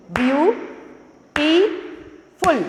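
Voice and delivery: "Beautiful" as three slow, separated beats; view, टी, फुल, long pause after each in "beautiful"; one clap per syllable of "beautiful"; clapped syllables. Shown as a demonstration. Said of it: "clap करके बता रही हूँ".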